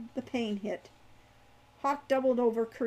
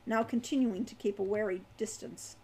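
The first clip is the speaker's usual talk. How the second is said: ordinary delivery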